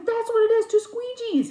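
high, loud triumphant insistence; excited exclamation. Retold or imitated. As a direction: cry it out, thrilled to have solved it. Retold excited reply after revelation.

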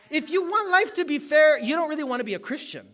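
loud, high and fast, driving the point home; a preacher's full-force shout; preaching shout. Acted. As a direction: shout it from the pulpit.

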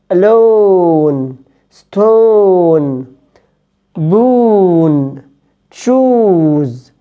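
ستون said slowly with a long rounded "o"; long English o. Shown as a demonstration. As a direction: hold the long "o" in ستوف، ستون، بون، تشوز. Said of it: "بنطقها أو". (أو طويلة ببطء)

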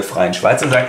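ordinary talk, nothing marked

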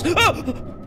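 high, startled "oh"; sudden high cry of surprise; startled yelp. Real, not acted. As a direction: yelp as if jumped by something. Startled during horror game.

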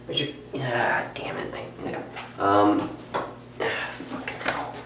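cursing softly under the breath; frustrated muttering. Real, not acted. Actor stumbles on the audition line, muttered frustrated cursing. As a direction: swear quietly at your own slip.

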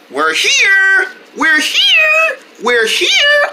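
high happy repeated call; excited cheer. Real, not acted. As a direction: announce arrival with joy. Arrival cheer.

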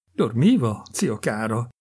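plain reading voice

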